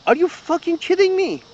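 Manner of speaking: can't believe what he's hearing; exasperated disbelief. Real, not acted. Ranting at a pundit's claims.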